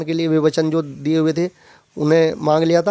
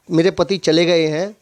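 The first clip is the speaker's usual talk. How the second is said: usual speaking voice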